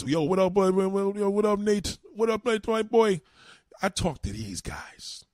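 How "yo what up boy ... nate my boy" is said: loud, high West Indian greeting to a buddy; West Indian accent. Retold or imitated. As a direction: hail your boy in a lightly put-on Caribbean accent.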